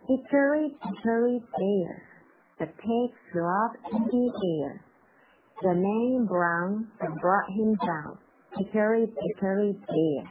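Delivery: measured, unhurried nursery rhyme. Shown as a demonstration. Teacher reads the first of three speeds, rather slow. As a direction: recite the rhyme slowly for learners. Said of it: "第一个相对来说是比较慢一点的".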